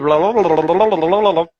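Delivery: unbroken stream of gibberish syllables, mimicking foreign speech; imitated babble. Recalling how English films used to sound to him, imitated babble. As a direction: run the blahs together like meaningless noise. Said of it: "era só os barulhos".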